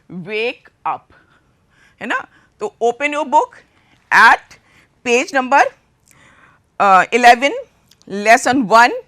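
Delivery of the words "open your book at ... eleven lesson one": louder, calling out the page number to the class; loud classroom instruction. Real, not acted. Teacher calling to her class to open their books, loud. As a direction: project it so the back row hears.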